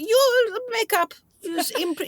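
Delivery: high, drawn-out director impression; imitating the director. Imitates the director improvising instructions.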